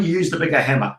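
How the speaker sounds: usual speaking voice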